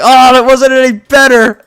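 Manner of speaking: loud, high-pitched retold groan of failure; mock dismay. Telling viewers about the sneeze that backfired, mock dismay. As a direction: exclaim it in exaggerated dismay.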